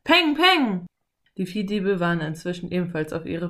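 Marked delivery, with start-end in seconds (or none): 0.0-0.8 loud, high bang-bang; punchy shouted shot noises; imitated gunshots. A narrator's reading. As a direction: fire off spoken gunshots. Narrator voices gunshots.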